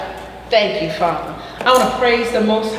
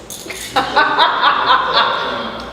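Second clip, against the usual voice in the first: long run of laughter; real amused laugh; 2 semitones higher than usual. Real, not acted. Teacher laughs at swallowing her clove, long real laugh. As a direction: burst out laughing at your own mishap.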